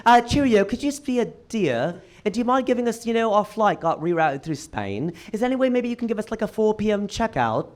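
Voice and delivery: hammed-up impression of a British guy; British accent. Friend on FaceTime sweet-talking the front desk, British accent. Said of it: "doing an impression of a British guy".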